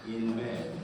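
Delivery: plain reading voice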